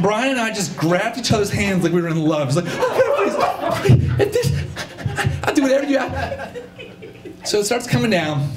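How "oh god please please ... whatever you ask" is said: very high-pitched frantic begging; high, desperate bargaining prayer; desperate mock pleading. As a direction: beg God in a comic squeal. Desperate mock prayer as the van approaches.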